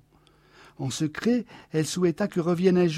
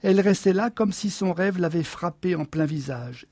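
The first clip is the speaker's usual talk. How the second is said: usual speaking voice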